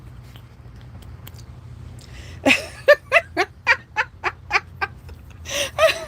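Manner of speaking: very high breathless, voiceless wheeze of laughter; wheezing silent laugh. Real, not acted. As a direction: laugh without voice, gasping.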